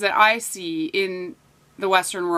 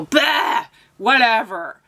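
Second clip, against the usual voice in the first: Bah tossed off sharply higher, mock dismissive; dismissive scoff; 3 semitones higher, slower than usual. Imitating her date brushing off her opinions, mock scornful scoff. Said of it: "he would literally go".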